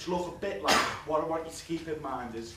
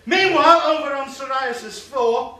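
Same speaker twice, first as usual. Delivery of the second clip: loud, high, slow scene change; announcer again; 11 semitones higher, 15 dB louder, slower than usual. Acted. Comic restarting the scene change, in a big trailer-announcer voice. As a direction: boom it like a film trailer.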